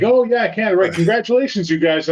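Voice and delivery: high, chirpy mock congratulations; mocking a cheerful author. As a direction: play the gushing author who's happy for them. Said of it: "He was like".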